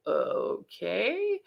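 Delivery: drawn-out okay with rising end; slow, questioning, humoring agreement; hesitant okay. Retold or imitated. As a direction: stretch it out and lift it, unsure. Chris humors the loopy patient.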